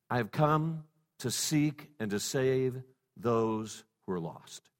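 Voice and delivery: quiet tender slow delivery; hushed and slow. Acted. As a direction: breathe it out gently like Jesus. Jesus' words, slow and hushed.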